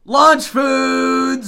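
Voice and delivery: loud high topic call; hype announcement. Acted. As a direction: announce it like a show host.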